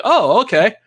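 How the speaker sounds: high-pitched mock acknowledgment; caught-out reaction. Herb is called out by Vision, high mock acknowledgment. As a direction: pitch it up, playing innocent. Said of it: "He's like".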